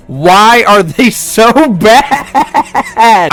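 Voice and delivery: high, loud, very drawn-out laughing cry; hammed-up exasperation breaking into laughter; exasperated wail. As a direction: wail it in comic despair. Exasperated at bad Marine drivers.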